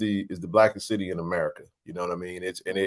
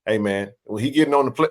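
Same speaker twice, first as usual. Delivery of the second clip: ordinary delivery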